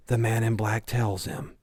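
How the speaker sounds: usual speaking voice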